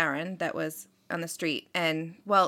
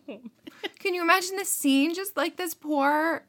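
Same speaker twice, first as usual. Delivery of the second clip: higher, amused, half-laughing; 8 semitones higher than usual. Real, not acted. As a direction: grin through the grim picture. Laughing at awful image.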